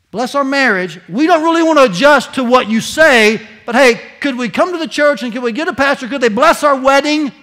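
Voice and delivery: loud, high, scornful voice of the couples; hammed-up couple asking the church for a wedding blessing; mocking engaged couples. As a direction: lampoon their demand for a blessing. Mocking couples using God.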